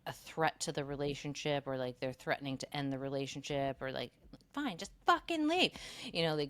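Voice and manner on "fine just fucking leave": high, loud huffy breakup line; hammed-up fed-up partner; mock angry outburst. Retold couple fight, partner's quote.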